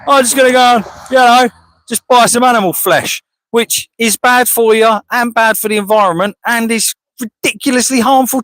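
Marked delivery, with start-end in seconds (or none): 0.0-3.2 high, loud careless shopper voice; mock a shopper, higher and louder. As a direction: sneer at the oblivious shopper. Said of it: "and goes".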